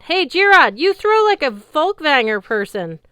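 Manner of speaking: high, loud taunting yell. A narrator's reading. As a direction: shout the insult up at the giant. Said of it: "I yelled at the giant".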